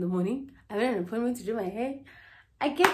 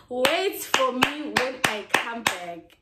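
slow, drawn-out plea; 3 semitones higher, slower than usual. Shown as a demonstration. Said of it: "repeats it slowly, stretched".

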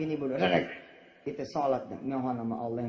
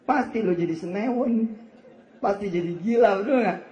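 laughing loud tease; 6 semitones higher, 7 dB louder than usual. Real, not acted. Preacher teasing the crowd, laughing.

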